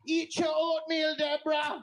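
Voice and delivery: high, loud mock yell at a patient; mock care nurse yell. Nurse yelling at an old patient, high mock yell. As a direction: shriek it like a bossy nurse.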